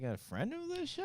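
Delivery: high skeptical rising question; doubtful question. Retold or imitated. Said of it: "where we still be like".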